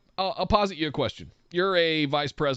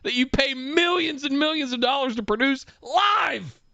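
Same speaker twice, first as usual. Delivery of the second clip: exasperated rant; 7 semitones higher than usual. Real, not acted. Co-host ranting about the boss's own show, exasperated shout. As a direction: build to a high, incredulous cry.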